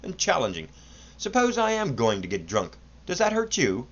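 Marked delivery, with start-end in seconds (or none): 1.2-3.9 higher, lightly voiced challenge; insolent challenge. A narrator's reading. Son challenges his father insolently, voice rising high at the end. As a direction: needle him insolently. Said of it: "The boy's tone was insolent and challenging".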